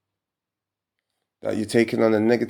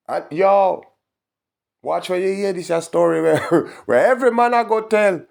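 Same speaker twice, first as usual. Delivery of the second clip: hyped rant; 8 semitones higher, 4 dB louder than usual. Real, not acted. Excited ranting, high and loud.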